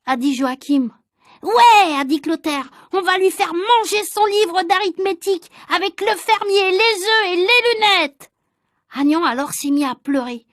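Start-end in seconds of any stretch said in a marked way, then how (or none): 1.4-1.9 put-on a loud, high "Ouais" from a rowdy boy; boy's loud eager yes; eager shout. Clotaire eager agreement. 2.9-8.3 put-on higher, slowing boyish threat; gleeful schoolboy menace; mischievous boy. Clotaire threatening Agnan.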